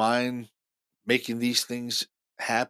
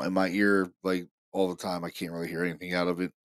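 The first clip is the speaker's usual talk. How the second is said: ordinary talk, nothing marked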